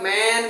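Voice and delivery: ম্যান with the vowel drawn out long. Shown as a demonstration. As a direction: stretch the vowel in ম্যান, as the wrong version. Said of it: "এটা long sound হয়ে যাবে".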